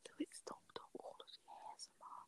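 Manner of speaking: soft whisper into the mic. Real, not acted. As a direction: keep it hushed, parents asleep. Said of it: "I'm whispering into my mic".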